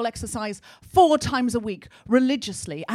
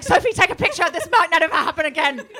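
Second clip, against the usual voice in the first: higher, louder, urgent laughing shout; excited plea; 6 semitones higher, 8 dB louder than usual. Retold or imitated. Calling to Sophie to photograph the balance, excited. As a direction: beg her friend to capture it. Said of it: "I was like".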